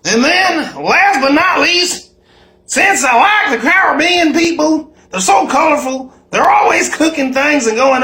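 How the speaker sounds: high and loud, gushing; excited. Real, not acted. Showing viewers his cheesecake, loud and excited. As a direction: shout it out proudly.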